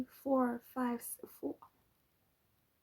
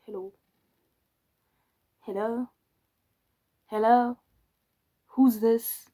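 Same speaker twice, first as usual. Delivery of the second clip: calling hello; 9 dB louder, slower than usual. Retold or imitated. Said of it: "It's like".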